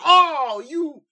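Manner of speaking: very high, loud mock gasp; mocking high squeal. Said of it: "people are gonna be like".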